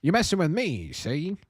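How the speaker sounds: lightly put-on 1950s gangster; a hint of a wiseguy from an old movie; old timey gangster voice. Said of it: "Old timey gangster voice time".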